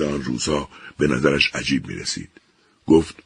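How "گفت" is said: ordinary delivery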